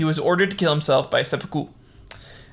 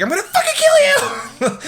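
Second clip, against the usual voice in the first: laughing hammed-up shout, much higher and louder; mock yell; 5 dB louder than usual. Asano attacking Kira, laughing hammed-up yell. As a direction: yell the threat through laughter. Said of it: "he's like".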